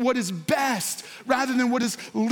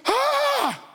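sudden high delighted "Ah!"; high joyful cry of discovery; excited gasp; 13 semitones higher than usual. Pretend. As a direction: gasp like you just struck treasure. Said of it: "you go".

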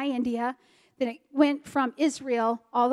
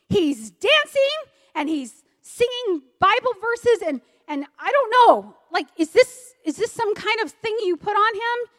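bewildered complaint; 9 semitones higher, 4 dB louder than usual. Retold or imitated. Pastor complaining his son is singing and dancing, bewildered and worked up. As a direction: pitch up in exasperated disbelief, stretching key words.

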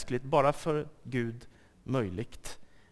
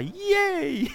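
squeaky high mock cheer; 13 semitones higher than usual. Preacher mocks the idea that doubt helps, in a high mock cheer. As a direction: fake delight, sarcastically. Mocking doubt bringing joy.

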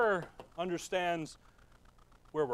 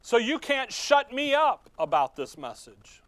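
higher, louder, slow proclamation as Paul; voicing Paul; 6 semitones higher, 6 dB louder than usual. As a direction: declare it like an apostle who won't be silenced. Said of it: "Paul goes".

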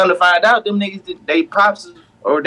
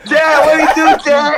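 calling out; 8 semitones higher, slower than usual. Real, not acted. Calls out to a friend over the noise, shouting. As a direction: call Chad's name over the noise.

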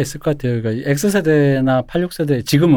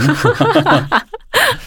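high burst of laughter; 12 semitones higher than usual. Real, not acted. Laughing at own confession.

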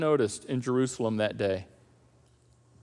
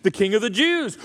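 normal talking voice

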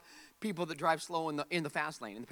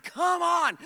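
impatient groan; 10 semitones higher, 5 dB louder than usual. Retold or imitated. Impatient behind slow driver.

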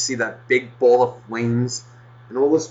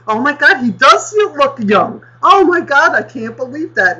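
high gushing mock voice, louder; mocking gushing clerk; 8 dB louder than usual. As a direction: ham up a fawning clerk. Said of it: "is looking at my I D going".

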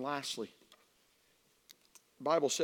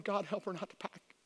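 soft, slightly high retold plea; hushed imitation of a desperate prayer; begging prayer; 5 semitones higher, 3 dB quieter than usual. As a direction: plead quietly with God, retelling it. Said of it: "I begged God".

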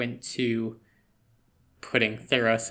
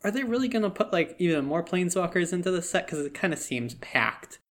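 quoted own reaction; 6 semitones higher than usual. Retold or imitated. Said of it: "I was like".

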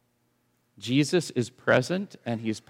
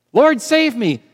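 high, loud cry for help; desperate plea; 12 semitones higher, 9 dB louder than usual. Pretend. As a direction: call out as if drowning.

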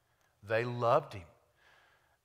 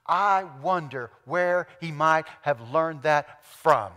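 loud, slow, raised sermon delivery; full-force preaching; 5 semitones higher, 11 dB louder, slower than usual. Real, not acted. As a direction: drive each word home to the congregation.

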